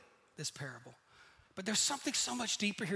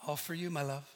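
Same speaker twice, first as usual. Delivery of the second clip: humble brag; 6 semitones lower, 6 dB quieter than usual. Retold or imitated. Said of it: "You're like".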